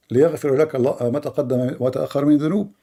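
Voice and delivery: ordinary delivery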